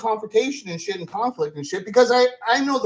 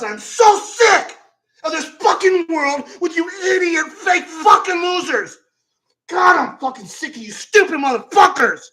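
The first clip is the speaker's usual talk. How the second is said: furious shout; 4 semitones higher, 5 dB louder than usual. Real, not acted. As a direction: scream your disgust at them all. Furious rant peak.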